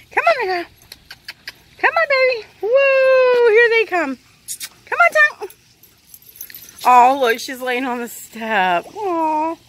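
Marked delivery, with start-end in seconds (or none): 0.1-5.5 excited dog call. Real, not acted. Owner urging the dogs to come on, excited calling.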